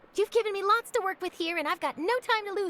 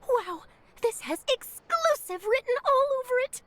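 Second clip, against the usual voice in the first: excited; 7 semitones higher than usual. Acted. Journalist thrilled by a scoop.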